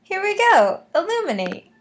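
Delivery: put-on bright magical girl. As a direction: call the spell out like a magical girl. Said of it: "Magical girl transformation sequence".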